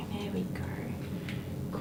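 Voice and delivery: slow soothing whisper to the dog. Real, not acted. Groomer whispering slowly to a nervous dog. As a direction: breathe the words, barely voiced, slowly.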